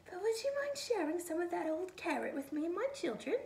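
a timid rabbit, whispered and high. A narrator's reading. Rabbit politely asks the snowman, high and timid. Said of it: "she said".

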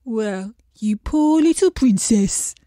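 a gloomy fish sighing over its looks. A narrator's reading. Fish to the princess, gloomy and self-pitying. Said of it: "said the fish".

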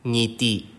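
"Ngiti" beginning on the ng of sing; teacher's model of "Ngiti" with velar ng; initial ng sound. Shown as a demonstration. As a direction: sound the ng from the back of the throat to open "Ngiti". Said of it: "more challenging when placed at the beginning".

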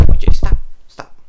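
loud hissed scolding; sharp scold. Real, not acted. Loud hissed scold at the pet jumping up. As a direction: snap it at the misbehaving pet.

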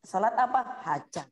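normal talking voice

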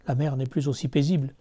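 plain reading voice

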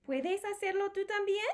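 put-on high, sing-song question to little kids; high playful read-aloud question; reading to children. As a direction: ask it brightly like a storybook refrain. Book's refrain to kids.

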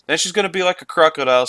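ordinary talk

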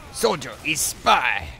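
slow, badly faked French-accented Russian heavy; poor French accent. Gamer mimicking the heavy warning of a spy, poor French accent. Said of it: "French accent for, uh, Russian heavy".

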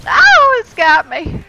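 high, loud yelp as something grabs you; alarmed cry. Real, not acted. Gamer to teammates when grabbed, alarmed cry. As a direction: cry out that you're caught. Player grabbed in game, calls out.

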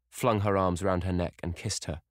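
ordinary delivery

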